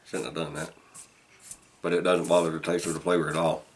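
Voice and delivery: speaking around clenched pipe; pipe in mouth. Real, not acted. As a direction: lips barely moving. Seen 4: Pipe stem held in teeth throughout labelled words; refs without pipe.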